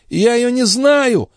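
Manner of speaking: high, drawn-out denial; anxious servant's high-voiced excuse; flustered protest. A narrator's reading. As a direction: protest ignorance under questioning. Questioned porter protests.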